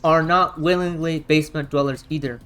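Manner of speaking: ordinary talk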